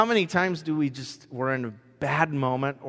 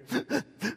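imitated gasp; 10 semitones higher, 8 dB quieter than usual. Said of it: "all of a sudden I hear".

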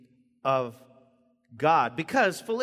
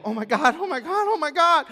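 much higher, louder excited oh my Gods; excited exclaiming; 11 semitones higher, 8 dB louder than usual. Retold or imitated. Woman in the video, mock excited exclaiming. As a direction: blurt it out in a rush of excitement. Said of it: "like, in that video".

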